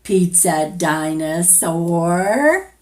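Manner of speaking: playful roar. Acted. To the child, answering the pizza dinosaur, roaring.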